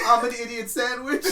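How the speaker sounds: high, loud mock confession; hammed-up wail of self-abasement; humiliated contestant. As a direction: imitate a browbeaten cook, half laughing. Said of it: "She's like".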